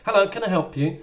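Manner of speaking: high, loud chirpy shop greeting; shop assistant. Retold or imitated. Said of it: "they go".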